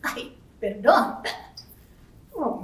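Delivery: ordinary talk, nothing marked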